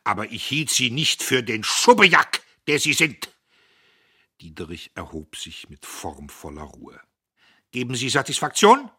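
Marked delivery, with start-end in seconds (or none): 0.0-3.3 much higher and louder outburst; furious insult. Acted. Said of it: "Göppel sprang auf". 7.7-8.9 loud, higher formal challenge; haughty challenge. Acted. Diederich fordert zum Duell.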